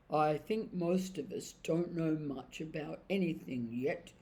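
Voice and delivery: slow, puzzled horse voice; very slowly. Acted. Horse slowly answering the crowd, muddled and unhurried. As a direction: drag each thought out like waking from a dream. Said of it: "said Strawberry very slowly".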